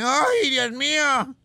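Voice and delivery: stretched-out "¡Ay, Dios mío!" in a put-on voice; slow mock terror. Commander Vergara feeling the drop, slow put-on cry. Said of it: "La cara que pone".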